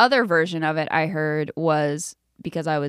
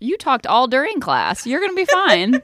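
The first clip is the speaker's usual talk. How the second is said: higher, louder retold pep talk; building in pitch as if protesting; mock exasperated reassurance; 7 semitones higher, 6 dB louder than usual. Friend retelling a pep talk to a nervous panelist, exasperated.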